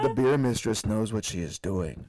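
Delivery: low, loud mock-sinister proclamation; hammed-up ominous announcement; terrifying voice. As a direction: put on a deep, menacing voice. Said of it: "the most terrifying sound I've ever".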